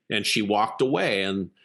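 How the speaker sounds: usual speaking voice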